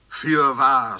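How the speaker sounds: a loud narrated cry. A narrator's reading. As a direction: burst out with the word. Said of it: "reißt er die Brust sich auf und schreit". (lauter Aufschrei)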